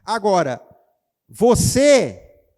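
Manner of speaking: loud, high emphasis on você; single out the listener loudly; loud pointed call. Real, not acted. Pregador aponta para o ouvinte. (ênfase alta em você)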